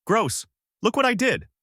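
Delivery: high-pitched yell of disgust. A narrator's reading. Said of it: "She yelled out".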